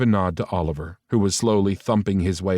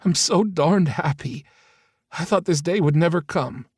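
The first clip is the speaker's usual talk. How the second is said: a mother welling up with joy; sniffly, happy-tearful mother; teary happiness; 9 semitones higher than usual. A narrator's reading. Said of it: "Leslie sniffled".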